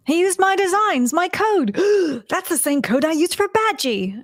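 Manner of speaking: high-pitched imitation of panicked realisation; Rutherford's dawning alarm. Co-host quotes Rutherford on his code, mock alarmed high voice. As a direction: gasp midway as it dawns on you.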